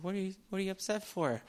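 high, very soft questioning voice; acted puzzled innocence. Moses asks an angry God why, high and soft.

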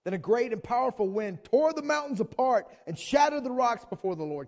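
high, loud preaching read; loud impassioned Bible reading; forceful scripture reading. A narrator's reading. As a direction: proclaim the verse at full volume. Preacher reads scripture at full force.

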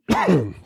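rough throat-clearing cough; chesty cough. Real, not acted. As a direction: clear the throat hard, mid-sentence. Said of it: "I'm sorry, I'm coughing".

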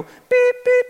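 high electronic button beeps; imitated beeps. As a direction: beep like treadmill buttons being pressed. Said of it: "and you go".

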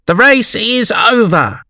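high, slow triumphant announcement; calling out. A narrator's reading. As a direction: shout the finish to the runners. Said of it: "the dodo suddenly called out".

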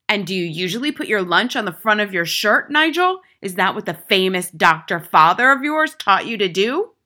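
loud, scornful questioning; mock the boy loudly, rising sharply on his name; sneering schoolmistress; tyrannical headmistress, sarcastic. A narrator's reading.

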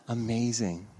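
a soft gasp, then quiet wonder; hushed amazement; awed. Real, not acted. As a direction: breathe it out in awe. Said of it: "the storyteller's own awe".

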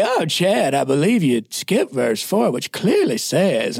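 sing-song Southern voice, drawn out at the end; Southern pastor impression. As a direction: imitate a learned Southern pastor correcting a pupil. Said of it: "he talked like this".

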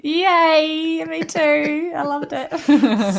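high, gleeful cheer breaking into laughter; excited delight. Real, not acted. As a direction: squeal it happily, stretching the last words. Guest delighted at podcast's end.

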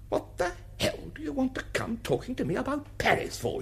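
high-pitched, rude retort, not shouted; quoting an unpleasant, testy outburst; uncommonly unpleasant. Retold or imitated. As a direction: mimic a rudely irritated man's high-pitched challenge. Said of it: "uncommonly unpleasant way".

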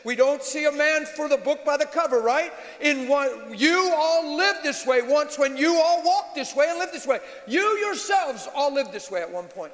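high, emphatic pulpit delivery; forceful preaching. Real, not acted. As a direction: preach it hard, stretching the key phrases.

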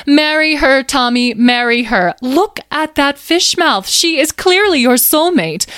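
very high, excitable creature voice; gleeful teasing Carnelian. Acted. Carnelian urges Tommy to marry Megan, gleefully. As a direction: crow it high, egging him on.

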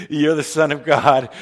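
a hint of a schmoozing insider, loud and chuckling; religious leaders. Religious leaders talking to Jesus, lightly mocked with a loud laugh. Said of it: "Religious leaders of Jesus' day would have said".

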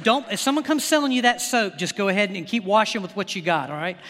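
ordinary delivery

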